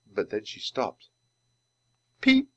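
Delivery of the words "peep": tiny high bird chirp; baby penguin peep. Acted. Stone penguin Penny comes alive, peeping. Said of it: "she greeted them".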